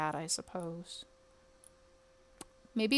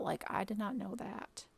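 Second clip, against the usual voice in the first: sheepish whisper; whispered aside; 8 dB quieter than usual. Real, not acted. Gamer admits to viewers she never knew, whispered. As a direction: whisper it like a confession.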